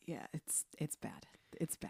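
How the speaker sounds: whispered, pained under the breath; whispered embarrassed aside; hushed dismay. Real, not acted. Groaning at the cringe scene.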